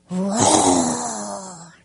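put-on playful lion roar for kids; mock lion roar. Shown as a demonstration. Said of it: "Can you roar?".

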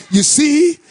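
high, shouted, slowing preacher's climax; full-force preaching. Acted. Pastor preaching to the congregation about praising God, shouting. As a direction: lift the congregation with it.

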